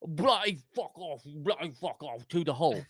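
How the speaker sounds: lightly put-on British accent, swearing at the hole; a hammed-up English golfer cursing the hole; playful mock British swearing. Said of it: "and he's going".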